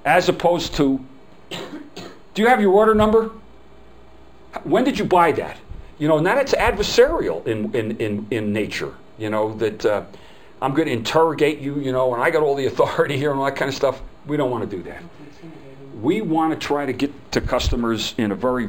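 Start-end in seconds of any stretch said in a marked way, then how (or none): 1.5-5.6 adversarial agent. Retold or imitated. Mocking a hostile service rep, interrogating tone. 10.6-14.0 mock interrogator. Mocks adversarial service attitude.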